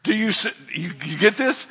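normal talking voice

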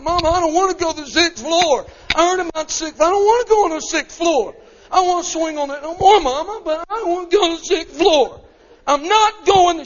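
put-on very high, loud child begging without pause; whining little boy. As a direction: wail it like a desperate kid.

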